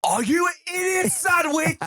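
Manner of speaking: slow, high, shouted insult like a furious TV chef; mock TV chef shout. Friend yelling a chef insult at his cohost, mock furious shout. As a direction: bellow it like a screaming celebrity chef.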